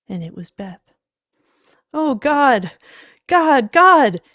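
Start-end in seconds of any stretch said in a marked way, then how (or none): 1.9-4.2 high, loud anguished wail; distraught full-voiced cry. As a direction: wail in overwhelming grief. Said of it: "he wailed".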